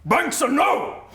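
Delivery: high, loud, drawn-out battle cry; full-voiced cry in an unknown tongue; dragon's shout. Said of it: "gets close enough and shouts".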